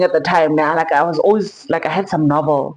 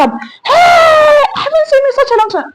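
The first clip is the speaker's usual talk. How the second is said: high, loud, overexcited mock reunion cry; mock gushing greeting; 5 dB louder than usual. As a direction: ham up a fake long-lost hello. Mocking parents' overdone reunion greeting.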